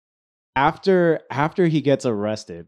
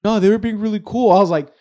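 high, louder exasperated scolding; 7 semitones higher, 7 dB louder than usual. Retold or imitated. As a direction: talk sense into a drunk friend. Scolding friend about trap.

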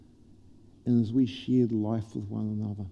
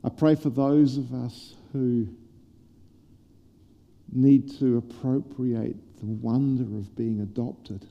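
closing prayer. Acted. The pastor praying over the church, hushed and earnest. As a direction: pray gently over the congregation.

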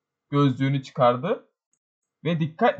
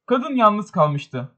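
usual speaking voice

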